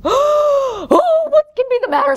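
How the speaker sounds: very high, loud fretting; alarmed zookeeper. Acted. Zookeeper finding the elephant lying down, alarmed. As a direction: fret loudly in a high voice.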